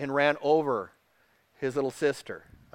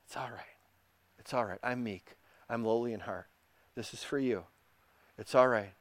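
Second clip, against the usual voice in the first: much softer and lower, soothing; gentle reassurance; 4 semitones lower, 9 dB quieter than usual. Acted. Jesus holding a hurting believer, gentle reassurance. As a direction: comfort someone you are holding close. Voices Jesus comforting the one he holds.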